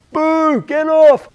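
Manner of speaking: loud, high mock heckle; loud high heckler's jeer; heckling. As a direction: boo the band off. Heckle from second row.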